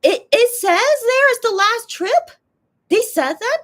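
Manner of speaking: high, loud, slow shocked question; shocked disbelief. Real, not acted. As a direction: gasp it out in disbelief. Shocked reaction to last-trip news.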